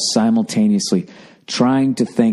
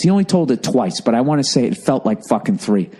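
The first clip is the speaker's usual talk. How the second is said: usual speaking voice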